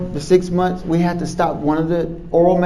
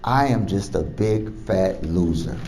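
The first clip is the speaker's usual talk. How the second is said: much softer, low, imitating her tears; tearful patient; 8 semitones lower, 8 dB quieter than usual. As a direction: lightly voice a crying patient's despair. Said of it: "she was crying".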